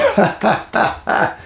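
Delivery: high burst of laughter; squeaky laugh. Real, not acted. Laughing about dying from laughter.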